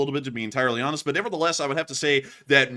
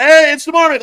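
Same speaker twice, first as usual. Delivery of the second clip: excited; 12 semitones higher, 14 dB louder than usual. Real, not acted. Excited at marmot image.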